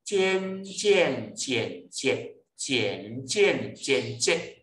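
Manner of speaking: 渐 slowly chanted through the eight tones; small mouth opening. Shown as a demonstration. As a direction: draw out each 渐 on its own tone. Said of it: "这就是喙小开哦".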